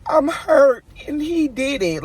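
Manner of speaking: put-on high, loud crying little kid; mock crying toddler, high and loud; whiny child. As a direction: whimper like a hurt four-year-old. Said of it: "run in the house and say".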